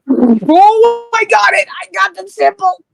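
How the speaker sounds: high, loud burst of joy; thrilled, delighted exclamation; excited cry. Real, not acted. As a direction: cheer on seeing the winning die. Player sees the winning roll.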